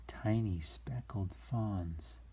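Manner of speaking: slow hushed whisper. Acted. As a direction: breathe it softly and slowly. Hushing for sleeping babies.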